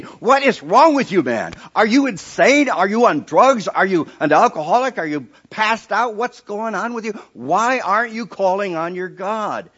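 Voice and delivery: loud, high furious yelling at the sleeper; captain raging at Jonah; angry captain. Acted. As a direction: shout at him to wake up. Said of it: "That captain was upset".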